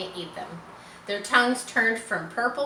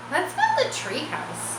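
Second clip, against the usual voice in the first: suggested; 8 semitones higher than usual. A narrator's reading. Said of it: "suggested Avery".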